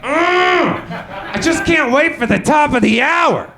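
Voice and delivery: high, bursting-with-excitement fan voice; hammed-up radio listener desperate for the song; mock eager anticipation. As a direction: squeal like you can hardly wait. Imitating eager listeners waiting by phone.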